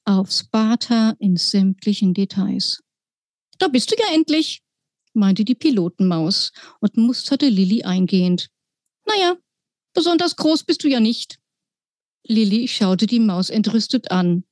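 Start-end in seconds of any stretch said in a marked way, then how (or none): 3.6-4.6 high, chirpy little mouse pilot. Acted. Pilot mouse greets the girl in a high squeaky voice. 9.1-11.4 high, chirpy little mouse pilot. Acted. Pilot mouse greets the girl in a high squeaky voice.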